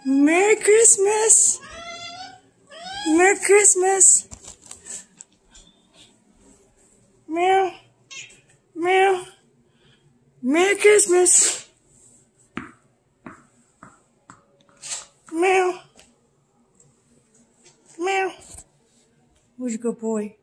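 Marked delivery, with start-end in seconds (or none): 0.0-5.0 very high, slow and louder sing-song; high pet voice. Real, not acted. Greeting the cat, squeaky high and drawn out. 7.3-18.3 high squeaky meows; cat meow. Pretend. Meowing back at the cat, high and squeaky.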